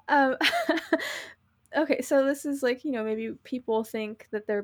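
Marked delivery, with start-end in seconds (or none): none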